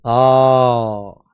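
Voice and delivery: आ, stretched slowly and loud from the back of the mouth; long open आ demo. Shown as a demonstration. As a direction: hold a long, loud आ from deep in the throat. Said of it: "तो देखो". (धीमा, लंबा और ज़ोरदार "आ")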